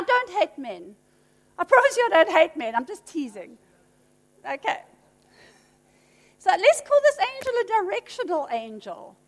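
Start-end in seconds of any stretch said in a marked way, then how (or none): none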